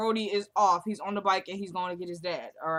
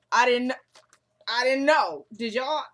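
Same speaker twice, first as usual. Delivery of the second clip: loud, higher, halting protest of surprise; real astonishment; 4 semitones higher, 7 dB louder, slower than usual. Real, not acted. Viewer stunned by Rel's story, astonished. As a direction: break off, then exclaim it in disbelief.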